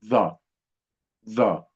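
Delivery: short, heavy interdental TH in "ظا"; tongue between teeth. Shown as a demonstration. Teacher has children repeat the sound, heavy interdental ظا. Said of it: "يلا ننطق صوته مع بعض".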